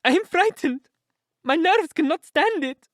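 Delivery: high, loud, terrified wail; half blubbering with fear. A narrator's reading. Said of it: "half blubbering with fear".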